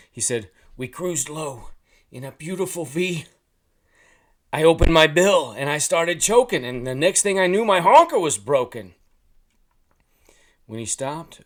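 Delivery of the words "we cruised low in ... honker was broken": goose voice building to very loud and high; talking goose. A narrator's reading. Goose telling how his honker broke, building loud. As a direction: start held back, then blare out the disaster.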